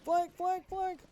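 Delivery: high urgent call of flank; called order. Acted. Calling teammates mid firefight, high urgent shout.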